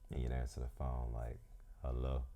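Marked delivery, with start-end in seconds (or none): none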